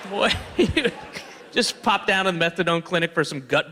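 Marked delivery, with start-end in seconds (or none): none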